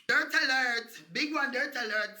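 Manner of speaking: loud announcing dirt alert; alarm call. Acted. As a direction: bark it like a siren warning. Mock roll call alarm.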